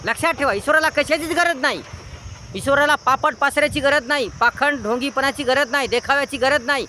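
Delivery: high-pitched, forceful sermon delivery; exhorting a congregation, voice lifted high; impassioned preaching. Real, not acted. Preaches with raised voice.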